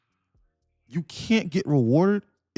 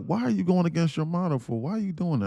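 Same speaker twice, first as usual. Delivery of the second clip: higher, disbelieving scold; mock scolding; 7 semitones higher than usual. Woman scolding him for trading, higher mock scold. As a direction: question him like she's fed up. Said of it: "she was like".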